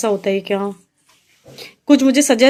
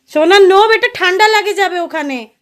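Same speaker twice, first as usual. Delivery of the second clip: loud warning; 7 semitones higher, 7 dB louder than usual. Real, not acted. Mother warning toddler she will get cold there, loud high call. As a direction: pull her back before she gets cold.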